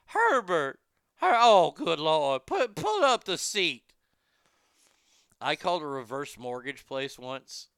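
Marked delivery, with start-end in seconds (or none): 0.1-3.9 put-on high, loud fussy old lady. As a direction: call out like a flustered granny. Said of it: "the old lady voice".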